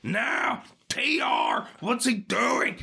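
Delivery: excited shout. Acted. Commentator reacts to top-rope move.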